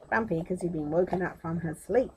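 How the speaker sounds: ordinary delivery, nothing marked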